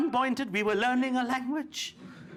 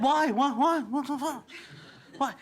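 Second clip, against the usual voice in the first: repeated why; 2 semitones higher than usual. Retold or imitated. Indians questioning the British, mock protest. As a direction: keep asking why, fading out. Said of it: "And we said".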